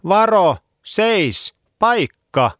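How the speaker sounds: very high, loud panicked shout; boy yells at the dog. A narrator's reading. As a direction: scream the warning. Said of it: "Olli huusi".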